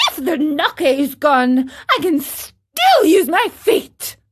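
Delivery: loud, high-pitched rage; furious puppet outburst; Pinocchio. Acted. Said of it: "blind with rage".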